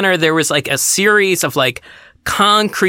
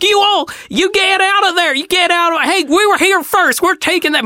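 high-pitched mock screaming in a Southern voice; hammed-up Southern tirade, pitched way up; unhinged Southern woman. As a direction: imitate a furious Southern mom ordering kids away. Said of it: "an unhinged Southern woman ... started screaming at the three of us".

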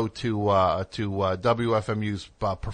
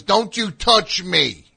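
slow, high and loud, drawn out; slow, loud, high-pitched warning; mock cop yell; 11 semitones higher, 8 dB louder, slower than usual. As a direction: bark it slowly like a touchy bully. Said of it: "He goes".

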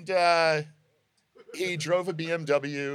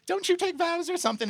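high, quick protest; high-pitched mock disbelief; retold incredulous question; faster than usual. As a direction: blurt it high and fast, taken aback. Retold startled challenge to priest.

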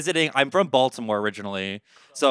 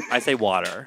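usual speaking voice

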